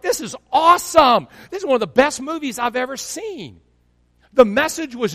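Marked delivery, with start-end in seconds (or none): 0.0-3.6 high, thrilled movie-fan outburst; excited raving. Retold or imitated. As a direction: relive being blown away, pitched way up. Reliving his thrill at movie.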